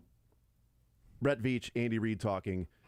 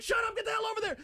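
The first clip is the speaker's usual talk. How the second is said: very high squeaky shouted order; a cartoon coach shooing someone off; mock Bieniemy yelling; much faster than usual. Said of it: "Eric Bieniemy's like".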